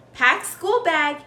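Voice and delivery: usual speaking voice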